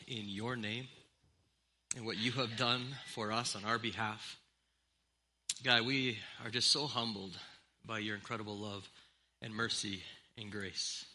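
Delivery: soft, hushed prayer voice. Acted. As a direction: pray quietly to God before the sermon. Pastor leads opening prayer.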